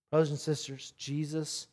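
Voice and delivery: usual speaking voice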